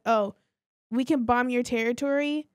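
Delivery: ordinary talk, nothing marked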